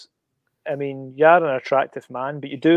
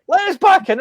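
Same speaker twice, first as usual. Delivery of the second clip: mock panic; 14 semitones higher, 4 dB louder than usual. Retold panicked plea.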